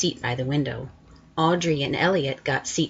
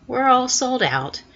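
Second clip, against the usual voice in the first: lightly voiced shoe man, higher and louder; a hint of a chirpy shopkeeper breaking the bad news; 8 semitones higher, 5 dB louder than usual. Shoe man telling the boy they're sold out, lightly voiced, higher and louder.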